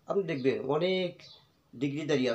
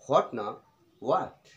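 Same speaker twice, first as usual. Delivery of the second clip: ordinary talk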